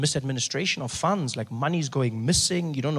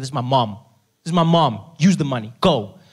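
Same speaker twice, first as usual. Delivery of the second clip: louder, firm demand; insistent demand; 7 dB louder than usual. Retold or imitated. Son ordering the nurse to spend, louder each time. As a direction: hammer it home to the nurse. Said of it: "I said".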